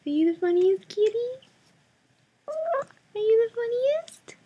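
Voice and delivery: high cooing voice for a kitty. Real, not acted. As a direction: coo up high at the cat. Baby talk to a cat.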